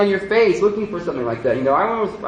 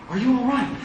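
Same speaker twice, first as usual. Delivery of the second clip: higher, a lightly voiced worried woman; concerned stranger; 8 semitones higher than usual. Walmart shopper asks the bowing man if he's okay, higher worried voice. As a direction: ask like a puzzled passer-by checking on him.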